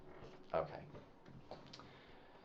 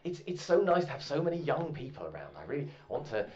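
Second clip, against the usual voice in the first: put-on louder, higher, gushing old man; fussy old Roger; 5 semitones higher, 4 dB louder than usual. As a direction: gush over the young people. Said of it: "And Roger says".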